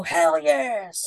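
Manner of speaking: ready to fight; pumped boys. Acted. Boys finally get brave, cheering. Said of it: "instead of going, "Oh," they go".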